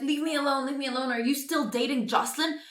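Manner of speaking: high, slightly whiny mock-drunk voice; drunk woman. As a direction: lightly imitate a drunk brushing someone off. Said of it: "she's drunk".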